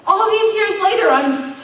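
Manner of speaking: impassioned sermon climax; full-force preaching. Acted. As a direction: preach it from the gut.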